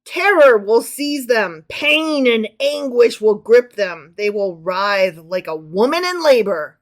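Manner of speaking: loud, slow doom-laden proclamation; dramatic scripture reading. Acted. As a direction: lean hard on each dire word. Reads scripture aloud to co-host.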